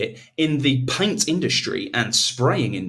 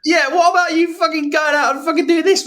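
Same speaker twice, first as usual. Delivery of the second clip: high sing-song mock suggestion; mocking advice voice; 14 semitones higher, 4 dB louder than usual. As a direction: lampoon a helpful mate. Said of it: "I went".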